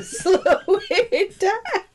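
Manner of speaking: usual speaking voice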